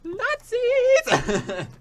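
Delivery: shrieks "Nazis!" very high, loud, through laughter; a mock-dramatic cry cracking into a laugh; laughing shout. Joking about the Nazi voices.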